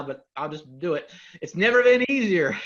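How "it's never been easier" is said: big, bright sales-pitch delivery, high and loud; infomercial voice. Acted. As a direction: sell it like a TV ad host. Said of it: "I sound like an infomercial now".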